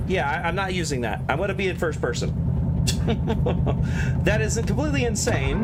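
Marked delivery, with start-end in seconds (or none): 2.4-4.2 unvoiced chuckling at something absurd; real laugh at a silly glitch; amused laugh. Real, not acted.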